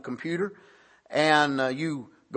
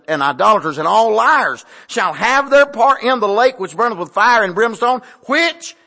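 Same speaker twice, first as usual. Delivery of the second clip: forceful reading; 4 semitones higher, 6 dB louder than usual. Real, not acted.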